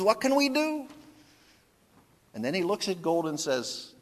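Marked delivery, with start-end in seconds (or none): 0.0-0.9 high, resigned husband; Tevye shrugging. Retold or imitated. Tevye gives in to Golda, high and resigned. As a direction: shrug it off to his wife. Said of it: "He says".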